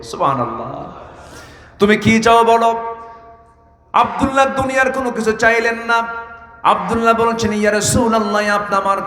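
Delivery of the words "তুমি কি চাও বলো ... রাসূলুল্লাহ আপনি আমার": high sermon chant; full-force preaching. Real, not acted. Preacher retelling Abdullah's request to the Prophet, impassioned preaching. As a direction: carry the dialogue on a high preaching tone.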